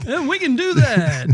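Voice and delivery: high, put-on lounge lizard croon. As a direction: play along as a cheesy lounge act. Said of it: "with our lounge lizard voice".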